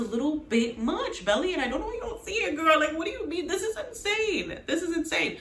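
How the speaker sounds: high, exasperated disbelief at Belly; incredulous rant. Real, not acted. Vlogger exasperated at Belly giving in, high incredulous rant.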